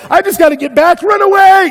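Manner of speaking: rising to a high loud panicked cry. Retold or imitated. Scared hiker deciding to flee, rising panicked shout. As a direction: build into a frantic urge to flee.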